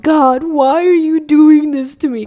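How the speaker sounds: loud, high, slow cry to heaven; mock anguished yell. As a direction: wail it dramatically at the sky. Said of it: "looked up to the sky and yelled".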